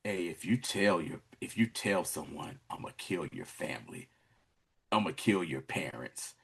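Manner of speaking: very low, hushed threat; imitating a menacing predator, barely above a murmur; child predator. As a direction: threaten softly, deep in your voice. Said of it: "and that's child predator, like".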